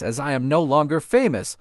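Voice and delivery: ordinary delivery, nothing marked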